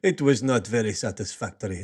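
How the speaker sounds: deadpan mock French accent; a French fan in a mock accent. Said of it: "I don't know why it sounds Russian".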